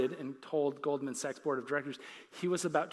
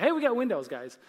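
mock cheerful; 8 semitones higher, 6 dB louder than usual. Staff joking about funeral home view.

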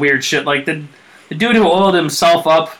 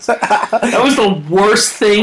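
laughing; 5 semitones higher, much slower than usual. Real, not acted. Laughing at bad theme song.

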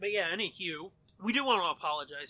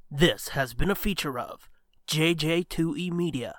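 announcer voice; 3 semitones lower, 9 dB louder than usual. Acted. Outro announcement.

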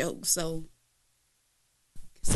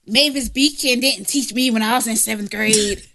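mock entitled plea; 3 dB louder than usual. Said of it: "I'm like".

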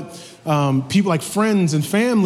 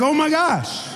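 higher, laughing sarcastic complaint; sarcastic exasperation; 6 semitones higher than usual. Retold or imitated. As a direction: customer mocking the worker, higher and laughing. Annoyed customer at the counter.

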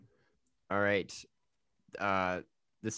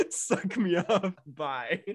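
slow deadpan; 5 semitones higher, much slower than usual. Retold or imitated. Rereads ending slower.

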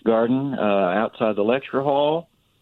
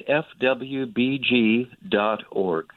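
slow; much slower than usual. Real, not acted. Slowly gives the web address.

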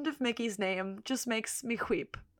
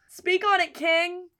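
a touch of hype-man cheer; hyped-up Sean; 8 semitones higher than usual. Retold or imitated. Quoting Sean hyping Fiona.

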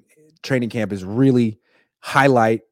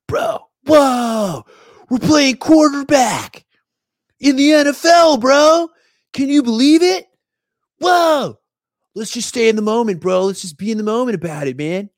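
Sam Darnold impression; 13 semitones higher, 6 dB louder than usual. Mocking the quarterback as a spaced-out surfer dude. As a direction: play him as a spaced-out stoner quarterback. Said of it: "That's my impression of Sam Darnold".

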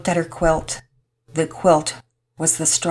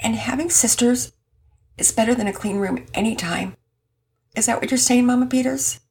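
shaky voice; 6 semitones higher than usual. Acted. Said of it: "voice began to get shaky".

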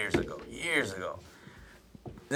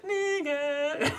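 very high, drawn-out mimicry; movie character impression; 13 semitones higher than usual. Driver quotes a movie parody, high drawn-out imitation. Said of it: "when he's like".

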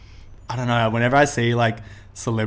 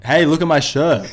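raised, higher voice to get attention; calling out; 4 semitones higher, 8 dB louder than usual. Retold or imitated. Fan to [name] in the crowd, calling out eagerly. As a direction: call out to a star across a crowd.

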